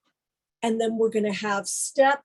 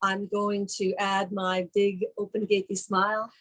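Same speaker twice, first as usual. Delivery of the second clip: usual speaking voice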